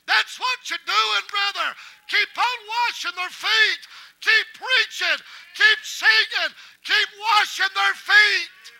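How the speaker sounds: high, very loud preacher's shout, stretching the words; full-force preaching. Real, not acted. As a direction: build to a slow, shouted exhortation.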